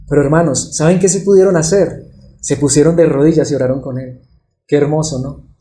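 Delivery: ordinary talk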